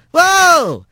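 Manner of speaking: high, startled cry; urgent high exclamation; alarmed Rat. A narrator's reading. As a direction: pitch it way up to halt someone. Rat stops the lurching Bobcat.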